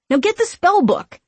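high, loud command; urgent order. A narrator's reading. Talking cat to Max, urgently ordering.

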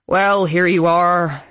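grudgingly irritated; irritable. Acted. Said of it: "said irritably".

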